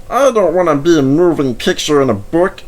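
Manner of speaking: a loud fretful protest. A narrator's reading. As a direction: refuse in a worried rush. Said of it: "protested Bert".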